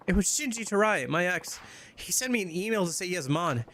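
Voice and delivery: put-on high, upset girlfriend voice calling to Majima; distressed young woman. Heroine names her ex.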